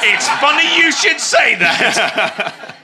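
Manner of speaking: slow loud laughing line; laughing shout. Real, not acted. Joke reply, laughing loudly.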